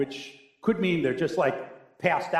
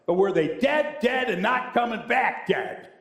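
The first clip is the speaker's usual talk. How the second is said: rising insistence on each "dead", breaking into a laugh; laughing emphasis on "not coming back dead"; 7 semitones higher than usual. Retold or imitated. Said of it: "which to us would sound like".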